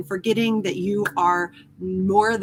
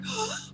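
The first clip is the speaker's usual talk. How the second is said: mock panicked gasp; 13 semitones higher, 10 dB quieter than usual. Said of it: "Or like".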